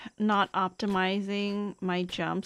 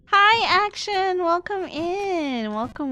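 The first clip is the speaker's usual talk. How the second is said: high, bright welcome to a viewer; excited hello; cheery greeting; 10 semitones higher, 5 dB louder than usual. Real, not acted. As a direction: sing out the hello.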